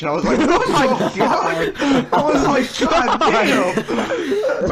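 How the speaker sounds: loud, high-pitched laughing disbelief; cracking up. Real, not acted. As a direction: barely get it out through shocked laughter. Cracking up at shocking customer remark.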